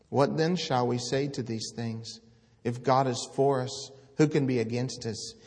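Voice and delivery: declaiming God's words from the pulpit; scripture proclaimed. Acted. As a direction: thunder the prophet's words to the congregation. Quotes Romans loudly.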